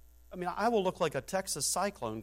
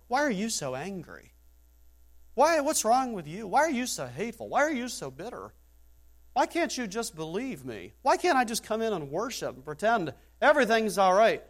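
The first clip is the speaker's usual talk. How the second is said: mock whiny sinner; 6 semitones higher, 4 dB louder than usual. Imitating an unrepentant sinner.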